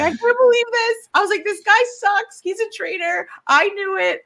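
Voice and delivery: high, indignant betrayed cry; furious fan reenacting; outraged disbelief. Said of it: "I was flipping out".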